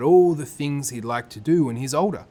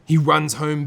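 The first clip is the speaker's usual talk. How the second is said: plain reading voice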